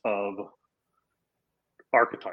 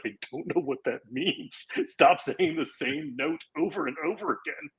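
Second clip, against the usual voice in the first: higher-pitched exasperated complaint, acted out; frustrated retort; 6 semitones higher than usual. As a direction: retell it as mock exasperation at the director. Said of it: "got frustrated".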